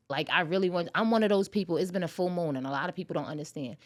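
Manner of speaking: ordinary talk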